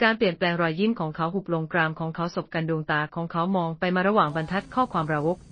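ordinary delivery, nothing marked

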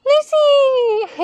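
high, loud laughing call. Real, not acted. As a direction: call out her name through a laugh. Calling to someone mid-stretch.

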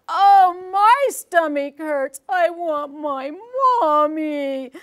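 slow, loud hammed-up wail; mock crying. Shown as a demonstration. Construction worker sobs for his mommy, mock crying loud and slow. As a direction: wail slowly like a sick big kid. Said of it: "the fast and slow".

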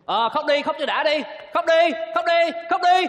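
loud, high, building harangue; bullying shout of impatience; angry yelling. Acted. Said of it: "Tại sao mày nạt mày la nó vậy?".

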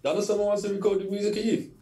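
high, fast put-on official voice; mock posh manager voice. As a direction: ham up a stuffy caller. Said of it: "thought it was a wind-up".

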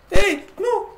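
high-pitched squeal of protest; startled squeal. Real, not acted. Player startled in the dark, high squeal. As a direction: yelp it way up high.

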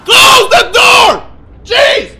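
very high, drawn-out loud shout; panicked scream. Real, not acted. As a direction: scream the order at full volume. Screaming at game during a chase.

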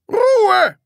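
high, loud enraged yell; furious shout. Acted. Teacher over laughing children, shouting furiously. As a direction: yell the class into silence. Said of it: "schrie Groll wütend".

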